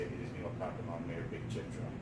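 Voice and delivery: quick, soft run through the phrase; rattled off. Shown as a demonstration. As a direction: reel it off fast and low. Rattles off the phrase just taught.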